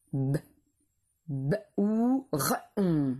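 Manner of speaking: "B, b, ou, r, on" one sound at a time. Shown as a demonstration. Teacher helping pupils spell the syllable, each sound apart. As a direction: isolate each sound of "B, b, ou, r, on", with gaps. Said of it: "Quatrième syllabe".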